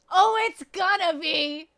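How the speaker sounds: high, drawn-out holler; booming, exaggerated warning; loud shout. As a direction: belt it out slowly and loud. Loud reply about painful accent.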